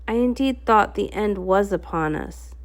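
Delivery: usual speaking voice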